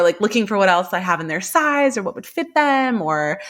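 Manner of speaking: ordinary talk, nothing marked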